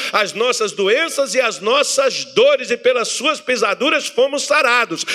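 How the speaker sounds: preaching at full force; fervent preaching. Acted. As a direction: drive the promise home with fire.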